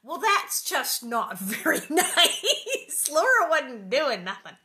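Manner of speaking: slow, loud, laughing protest; amused outrage at the sister; laughing indignation. Real, not acted. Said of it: "indignant comment on Mary".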